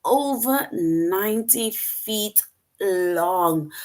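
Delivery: slow, drawn-out amazement; amazed. Shown as a demonstration. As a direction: tell it like it's astonishing news. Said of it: "'Cause you are expressing yourself".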